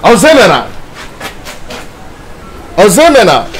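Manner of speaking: loud, high shout of a name; angry calling out. Acted. Angry man shouting for Ozemena over missing money, loud call. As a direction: holler for someone who is missing.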